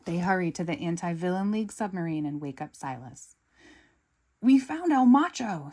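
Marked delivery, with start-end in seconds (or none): 4.4-5.7 higher, louder excited burst. Acted. Gru telling Silas the news, excited and loud. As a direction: blurt out the big news. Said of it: "says Gru excitedly".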